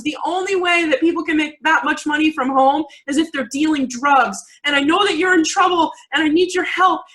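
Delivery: mock a panicked mom begging you to confess; panicking mom. Mother fearing her daughter deals drugs, frantic mock panic. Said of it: "panicking and doing, like, this whole mom crying thing".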